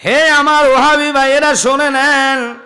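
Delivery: high, loud, stretched summons; calling out. Real, not acted. Preacher challenges his Wahhabi brothers to listen, calling out. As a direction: hail the opponents at full voice, holding the last word.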